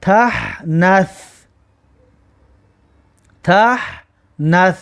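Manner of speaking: تحنث stretched very slowly; slow recitation. Shown as a demonstration. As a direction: draw out تحنث syllable by syllable. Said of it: "در آخر کلمه میشود که".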